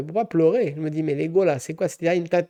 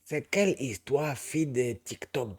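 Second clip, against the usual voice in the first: put-on Ivorian accent; 2 semitones lower, 4 dB quieter, slower than usual. Asking about TikTok girls in a light Ivorian accent. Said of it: "coupé accent ivoirien".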